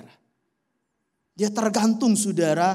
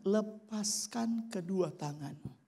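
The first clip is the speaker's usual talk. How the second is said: slow, softer divine command; voice of God; 7 dB quieter, much slower than usual. Retold or imitated. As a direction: speak it slowly like a calm God. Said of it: "Suara itu datang lagi". (suara Tuhan yang pelan dan lembut)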